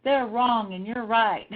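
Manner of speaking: loud, slow rallying cheer of support; emphatic agreement. Retold or imitated. Backing a mad friend, loud indignant agreement. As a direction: back them up with drawn-out, punchy conviction. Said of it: "What I need you to do is say".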